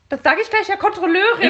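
high laughing snitch voice; whiny tattletale. Retold or imitated. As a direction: tattle with a giggle. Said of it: "es fängt mit dem Satz an".

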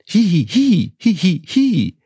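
very high spoken giggle; high, girlish robotic titter; doll giggle. Acted. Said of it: "Dann kicherte die Puppe". (hohes Puppenkichern)

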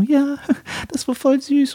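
very high put-on female voice, little laugh; lady's voice. Man posing as his girlfriend to her lover, lady's voice. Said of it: "Weißt du, ich rede so".

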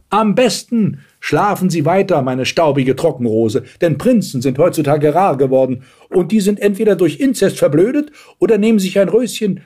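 high-pitched mocking old woman; scornful grandmother. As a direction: advise with haughty sarcasm. Grandmother mocks old Dornröschen. (spöttische Großmutter, hohe Stimme)

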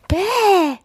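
high, loud mock wail. A narrator's reading. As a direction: moan it like a sulky hare. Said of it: "jammerte der Hase". (gespielt gejammert)

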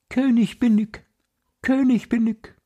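very high, piercing little bird voice; a tiny bird shrilling its claim from the sky; fine piercing voice. Acted. Wren calls down to the birds, very high and piercing. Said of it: "mit seiner feinen Stimme".

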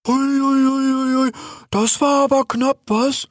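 high, loudish worried bear. A narrator's reading. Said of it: "fragte Altobello besorgt". (besorgt, mit hoher Bärenstimme)

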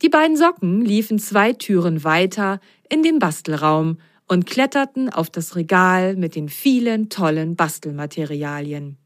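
ordinary delivery

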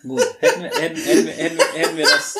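laughing. Real, not acted. Stumbles, laughing.

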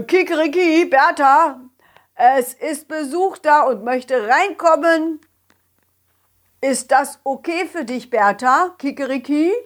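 loud calling rooster. Acted. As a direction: call inside like a rooster. Rooster calls hen.

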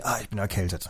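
soft, low, sighing complaint; a quiet, low moan about the cold; sickly sigh. Shown as a demonstration. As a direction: sigh it out like you feel lousy. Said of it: "du sagst dann gleich".

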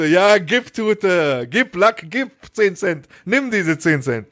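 high, slow, exaggerated pleading; hammed-up desperation to get out; mock exasperation. Exasperated reply to cashier. (übertrieben verzweifelt, hoch und langsam)

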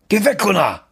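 high angry yell; shouting. Acted. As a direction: bark it at him. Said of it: "brüllt Niklas".

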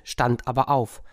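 ordinary delivery

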